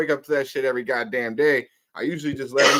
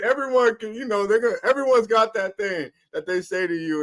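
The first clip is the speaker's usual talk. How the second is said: ordinary delivery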